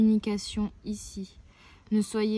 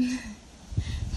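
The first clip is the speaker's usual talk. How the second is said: small amused chuckle; brief laugh breaking into the talk; stifled giggle at a funny sight. Real, not acted. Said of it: "pardon, je rigole".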